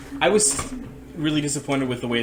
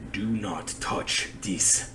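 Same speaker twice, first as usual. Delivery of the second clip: slow, low booming dragon voice; 3 semitones lower, 4 dB quieter, much slower than usual. Acted. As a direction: boom it out slowly like a dragon. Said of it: "Like in the dragon's voice?".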